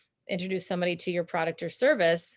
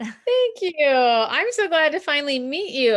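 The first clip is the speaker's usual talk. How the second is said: higher, louder, thrilled thanks; delighted; 8 semitones higher, 7 dB louder than usual. Real, not acted. Guest thanking the host at finally meeting, delighted. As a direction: gush with real delight, high and loud.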